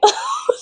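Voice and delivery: high squeaky laugh; squealing laugh. Real, not acted. Laughing at her own joke, squealing. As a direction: let out a tiny squeal.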